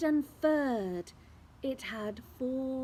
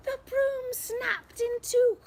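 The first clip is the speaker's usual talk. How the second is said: dramatic alarm; 13 semitones higher, 6 dB louder than usual. A narrator's reading. Broom breaks, dramatic moment.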